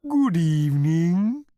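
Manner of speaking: high, slow, lowing cow voice; a cow greeting diners, drawn-out and mooing. A narrator's reading. Cow greeting the diners, in a slow lowing voice. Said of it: "it lowed".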